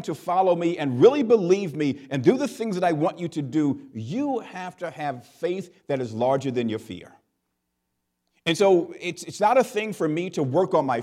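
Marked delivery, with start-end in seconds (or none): none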